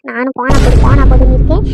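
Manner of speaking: loud, fed-up outburst; exasperated shout. Acted. Woman losing patience with the man, exasperated shout. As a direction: burst out as patience runs out. Fed-up threat during family quarrel.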